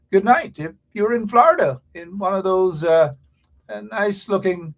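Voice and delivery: loud, high call-out to the listeners; a radio host hollering good night to Florida. Real, not acted. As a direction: belt the greeting out to Florida.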